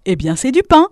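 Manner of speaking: contented proud cry; happy exclamation. A narrator's reading. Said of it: "s'écria le moissonneur tout content".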